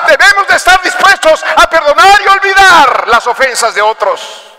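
rising into a high, loud preaching voice; quoting the preacher's sermon. Retold or imitated. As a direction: echo the pastor's words as a sermon. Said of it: "Me lo dijo el trailero".

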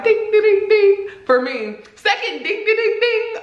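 high sing-song ding ding ding; ding ding bell. Pretend. Ranking the red flags, chiming ding ding. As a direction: ring it out like a little bell, laughing. Sound effect bell ringing, laughing.

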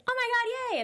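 high, squealing burst of joy; retold happy squeal at the good news; excited. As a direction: react to getting the part with delight. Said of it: "so I was like".

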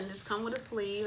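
normal talking voice

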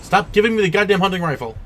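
fast, loud, frustrated complaint; exasperated rant. Real, not acted. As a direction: rattle off the complaint angrily. Frustrated by repeated loot.

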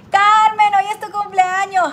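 excited shout-out to a viewer on her birthday; excited birthday call. Real, not acted. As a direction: call out to Carmen, thrilled.

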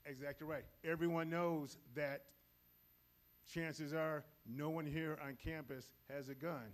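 normal talking voice

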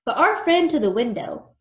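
usual speaking voice

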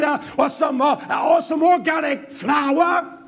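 high, fiery pulpit shouting; full-force preaching. Real, not acted. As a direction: hammer each phrase at the congregation.

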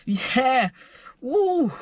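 high retold whooping at the girlfriend; imitating her wired self; hyped whoops. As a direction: get yourself pumped, half-comic. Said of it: "I was like".

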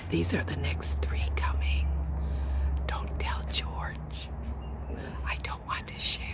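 hushed, secretive whisper. Real, not acted. Telling viewers not to tell George about the fruit, whispering. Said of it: "I don't know why I'm whispering".

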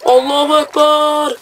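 slow, high, drawn-out war cry; mock battle cry. Gamer shouting a battle cry as his character charges, drawn out. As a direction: stretch it out like a charging warrior.

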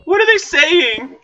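high, slow, stretched-out question; exasperated bafflement. Real, not acted. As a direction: draw it out in high-pitched bafflement. Baffled by villager noises.

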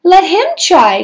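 commanding princess. Acted. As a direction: give the order like a princess. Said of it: "commanded the princess".

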